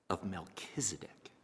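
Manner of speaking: breathy whispered heading. Real, not acted. Preacher whispering his sermon heading to listeners. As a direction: breathe the name like a secret.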